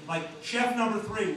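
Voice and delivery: usual speaking voice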